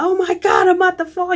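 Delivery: high, loud roller-coaster panic; panicked scream. Acted. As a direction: cry out as if about to plummet. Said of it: "You panic".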